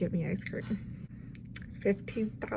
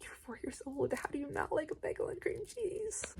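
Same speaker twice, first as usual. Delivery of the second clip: incredulous whisper; 6 semitones higher, 11 dB quieter than usual. Retold or imitated. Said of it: "It's like".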